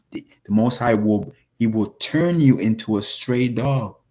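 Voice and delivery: normal talking voice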